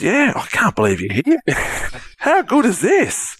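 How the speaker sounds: high, drawn-out excitement; excited stranger. Retold or imitated. Bloke thrilled to meet Cram, high and slow. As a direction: gush like you've met your hero. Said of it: "And he goes".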